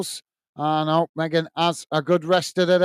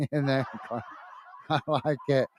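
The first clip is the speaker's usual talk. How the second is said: quiet laugh through the words; laughing softly; 4 semitones lower, 9 dB quieter than usual. Real, not acted. Streamer reading a viewer's joke about referees, laughing quietly. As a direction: chuckle as you repeat the viewer's joke. Amused by chat calling refs clowns.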